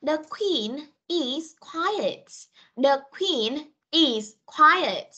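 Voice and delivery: very slow, word by word; slow teacher read-aloud with spaces between words; slow model reading. Shown as a demonstration. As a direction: model each word separately for learners. Teacher models sentence for pupils.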